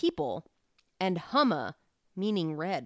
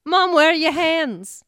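put-on higher, louder, slow little kid; 7 semitones higher, 8 dB louder, slower than usual. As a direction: ask mom like a puzzled child. Kid in the cereal commercial.

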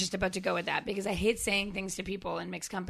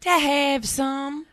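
mock echo; 5 semitones higher, 6 dB louder, much slower than usual. Echoing the quote mockingly.

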